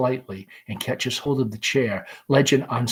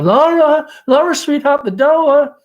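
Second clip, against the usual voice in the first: much higher, louder call from the kitchen; 13 semitones higher, 9 dB louder than usual. Acted. Mother calls daughter to answer the door, loud. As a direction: call her across the flat. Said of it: "Amanda calls".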